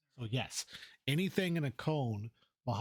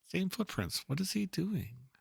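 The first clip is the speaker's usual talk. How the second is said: higher, puzzled seeker; searching hero voice; 4 semitones higher, slower than usual. Acted. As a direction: look around for him, curious and a bit worried. Voices searching game character.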